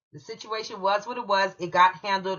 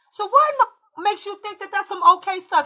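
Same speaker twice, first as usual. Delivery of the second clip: incredulous challenge; 10 semitones higher, 3 dB louder than usual. Retold or imitated. Confronting principal in retold quarrel.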